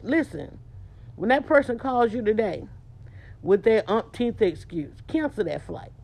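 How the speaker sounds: ordinary delivery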